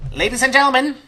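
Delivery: high-pitched stage announcement; gnome showman. Acted. Gnome introduces the fighter to the crowd, high showman's voice. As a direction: present each phrase grandly, with pauses.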